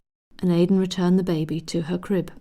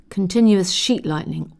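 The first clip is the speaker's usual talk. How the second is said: ordinary delivery, nothing marked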